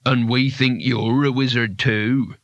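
slow, lightly voiced townsman; menacing townsman. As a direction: draw out the accusation slowly. Menacing townsman figure in the story.

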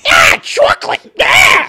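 very high, slow, loud cartoon shout; heightened high voice. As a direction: shriek it like a cartoon character. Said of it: "That's what I think I sound like".

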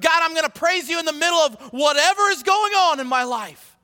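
loud, rising declaration of praise; preach. Acted. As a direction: preach it out with full conviction.

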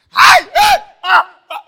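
loud, high yelps of a slapped actor; pained yelps. Acted. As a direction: yelp high and loud as if struck. Actor flinching from a slap.

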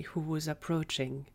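plain reading voice

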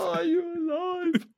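high, drawn-out Sim question; soft squeaky singsong, slowly stretched out. Acted. Sim asking an object if it's alive, Sim voice. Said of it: "go and talk to the, the inanimate object".

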